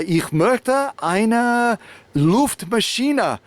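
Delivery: slow, high, careful beginner's German; high and slow, asking a shopkeeper in shaky German; halting learner German. Retold or imitated. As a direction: sound out each word like a nervous learner. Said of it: "I say, uh".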